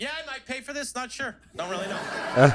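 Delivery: high, teasing maybe-I'll-pay; teasing shopper. Retold or imitated. Comic teasing shoppers while eating chips, high and sly. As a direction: tease the other shoppers. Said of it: "I look at people like".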